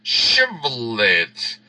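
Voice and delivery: "Shibboleth" drawn out slowly with sh. Shown as a demonstration. Guard demanding the password, slow careful "Shibboleth". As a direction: stretch "Shibboleth" out. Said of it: "ahora dime la palabra".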